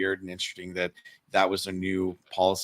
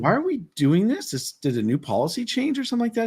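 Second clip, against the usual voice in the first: much higher-pitched retold questions; puzzled questioning; 14 semitones higher than usual. Retelling how he questioned the guard on new policy, puzzled. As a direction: replay your puzzled challenge in a high pitch.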